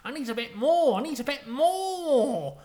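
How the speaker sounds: loud, high gambler's craving, building; hammed-up wail stretching the last word; frenzied rush. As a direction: beg greedily, climbing to a drawn-out cry. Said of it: "he's on a rush".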